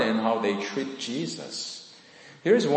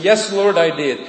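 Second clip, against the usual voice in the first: higher, louder eager reply; eager yes; 6 semitones higher, 8 dB louder than usual. A narrator's reading. Man on the branch answers God, eager and loud. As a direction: jump at the answer, desperate.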